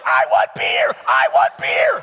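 put-on high, loud child shout, building; toddler yelling. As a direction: scream it like a demanding little boy. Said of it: "he's like".

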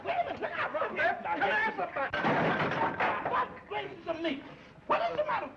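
very high, loud, half-laughing panic; terrified shriek. Acted. As the barber starts carving, shrieking in high-pitched terror. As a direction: shriek as the razor comes close.

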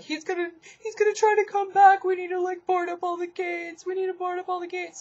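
high, fast frantic warning; panicked. Retold or imitated. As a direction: rattle it off in a panic. Friend freaked out after leaving.

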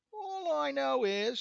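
high, loud, drawn-out whine; whiny rascal. A narrator's reading. Rascal starts his excuse to the devil, whiny. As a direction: whine it in self-defence. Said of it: "said the rascal in a whiny voice".

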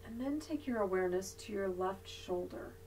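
soft, unhurried yoga nidra voice with long pauses; guided meditation. Real, not acted. Yoga teacher leading resting students through body awareness, guided meditation voice. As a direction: guide them gently into body awareness, leaving long silences.

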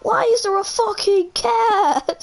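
high, whiny cry of an outraged son; Italian guy meme. Retold or imitated. Imitating the Italian guy from the video, a high, whiny wail. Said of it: "Have you seen that video?".

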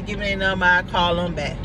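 ordinary delivery

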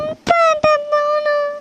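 put-on high, drawn-out tearful child's wail; crying. Scolded child wants to go to father, crying. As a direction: wail slowly for a parent. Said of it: "சும்மா அழுகாதட்டி".

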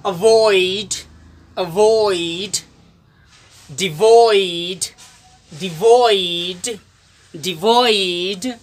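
अवॉइड and डिवॉइड with a clear oi glide; oi sound demo. Shown as a demonstration. Teacher models oi words for students. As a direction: drawl डिवॉइड slowly, stressing the oi. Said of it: "उसी तरह सभी शब्दों में उच्चारण होगा".

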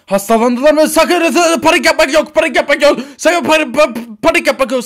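loud, high-pitched stammering panic; panicking. Acted. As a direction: panic wildly while ordering everyone not to. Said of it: "Panik yapan sensin". (panik içinde bağırarak)

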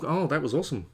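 ordinary talk, nothing marked

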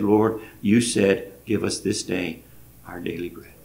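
softly praying, voice falling away; hushed prayer. Retold or imitated. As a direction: quietly ask the Lord for bread. Praying together for food.

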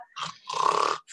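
long rasping snore with a whistling exhale; bear snoring. A narrator's reading. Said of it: "the big furry bear was sleeping".

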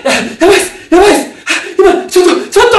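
loud, high alarmed cries; panicked shouting. Retold or imitated. Telling the crew he saw something, frantic loud panic. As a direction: gasp it out, scared stiff.

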